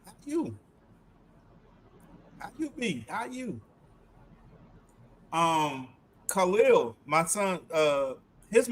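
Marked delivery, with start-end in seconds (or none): 0.1-3.6 Southern greeting. Retold or imitated. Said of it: "Or they'll say".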